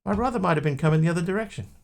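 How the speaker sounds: high, fast incredulous driver. Acted. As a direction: rattle it off as if obvious. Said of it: "He goes".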